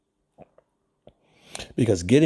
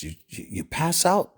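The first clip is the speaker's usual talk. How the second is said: usual speaking voice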